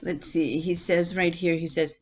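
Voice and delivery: ordinary delivery, nothing marked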